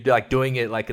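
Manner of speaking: usual speaking voice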